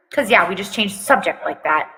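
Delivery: ordinary talk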